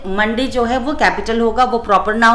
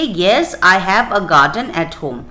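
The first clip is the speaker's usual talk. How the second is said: slow dictation; 3 semitones lower, much slower than usual. Shown as a demonstration. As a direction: model answer read very slowly. Teacher dictates model answer. (धीरे-धीरे लिखवाते हुए)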